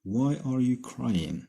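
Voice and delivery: each word spaced out slowly for the class; slow dictation reading. Shown as a demonstration. Teacher reading an example sentence to students, slow and clear. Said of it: "شوفوا مثال آخر".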